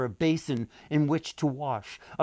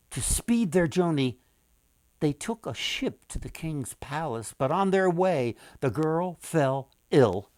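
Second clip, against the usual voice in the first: plain reading voice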